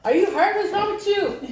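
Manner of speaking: a worried mom bursting out; frantic mother on the phone; alarmed mother. Retold or imitated. Mom alarmed by late-night call.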